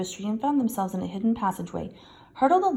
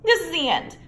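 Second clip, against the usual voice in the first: moaning despair; 9 semitones higher, 6 dB louder than usual. A narrator's reading. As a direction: moan it, doomed. Ron despairing.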